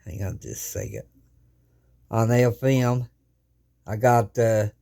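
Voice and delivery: ordinary talk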